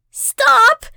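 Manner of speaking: high, loud desperate scream. A narrator's reading. Abigail screams through the tornado of flames, screaming. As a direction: scream it out over roaring flames. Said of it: "Abigail screams through the tornado of flames".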